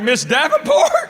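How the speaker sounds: high, drawn-out incredulous question; acted startled recognition. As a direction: blurt the name in astonished disbelief. Acts startled recognition of old teacher.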